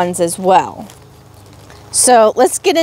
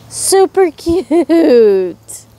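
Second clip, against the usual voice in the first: drawn-out gush; 6 semitones higher, much slower than usual. Real, not acted. Admiring princess locket.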